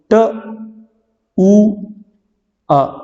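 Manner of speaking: "ट", "उ", "अ" said one by one with long gaps; sounds spelled out slowly. Shown as a demonstration. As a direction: sound out each phoneme separately. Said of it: "इसकी transcription क्या होगी". ("ट", "उ", "अ" धीरे धीरे अलग अलग)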